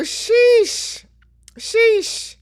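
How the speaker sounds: high, drawn-out sheesh, falling; shocked sheesh. Real, not acted. Reacting to friends trashing Emily, a high shocked sheesh. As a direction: let it out in disbelief twice.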